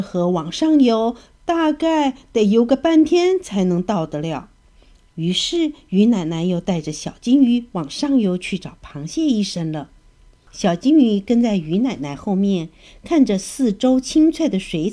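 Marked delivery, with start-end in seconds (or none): none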